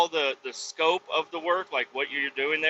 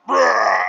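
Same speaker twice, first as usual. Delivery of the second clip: big, loud lion roar; Simba; 5 semitones higher, 9 dB louder than usual. Retold or imitated. Cub trying again with dad behind him, loud roar. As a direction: let out a sudden full roar, imitated. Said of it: "he tries it again, he goes".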